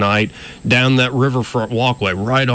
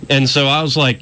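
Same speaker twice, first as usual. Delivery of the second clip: usual speaking voice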